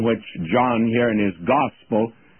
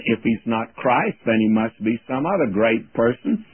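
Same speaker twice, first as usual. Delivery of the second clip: ordinary talk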